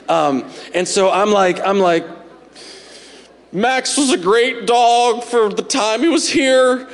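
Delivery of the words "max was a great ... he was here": slow, higher, choked-up eulogy; choking up. Retold or imitated. At the dog's funeral, father choking up slowly. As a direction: fight back tears over the dog. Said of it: "And I'm choking up".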